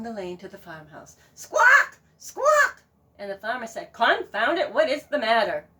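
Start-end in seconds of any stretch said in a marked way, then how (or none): 1.3-2.8 high shrieking squawks; goose squawk. Acted. Goose squawking to wake the farmer, shrieking. 3.9-5.7 loud, irritated grumble; grumpy farmer. Acted. Woken farmer scolding the goose, irritated.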